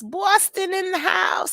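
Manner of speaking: high, drawn-out announcement on Boston; changed voice. Real, not acted. Welcoming a Boston viewer, high changed voice. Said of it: "I don't know why I have to change my, my, um, my voice when I say that word".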